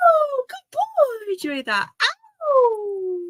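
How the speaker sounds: squeaky delighted pet praise; praising the dog. Real, not acted. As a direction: gush over the dog.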